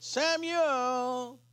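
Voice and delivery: drawn-out call of the name; voice calling Samuel. Retold or imitated. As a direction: call out in an ordinary human voice, stretching the name. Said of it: "and the voice said".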